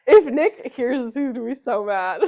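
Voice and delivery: giggling through the words; laughing. Real, not acted. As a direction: laugh while you say it. Laughing while telling.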